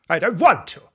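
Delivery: put-on loud, high childish refusal; defiant little girl. A narrator's reading. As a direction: snap back like a brat. Fanny refuses again.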